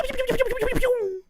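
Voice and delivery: very high cartoon pew pew; mock pew sounds. Mocking the fake gunshots in the beat with high pews. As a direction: squeak out toy laser shots.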